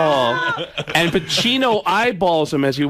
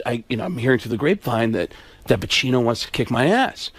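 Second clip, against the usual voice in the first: Alec Baldwin; 6 semitones lower than usual. Retold or imitated. Actor relaying a rumor about Pacino, low-key impression. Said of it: "So Alec Baldwin says".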